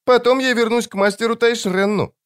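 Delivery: put-on very high childlike creature; child-voiced demon. A narrator's reading. Demon settles its errand with the wizard, child voice. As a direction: talk like an uncanny child demon.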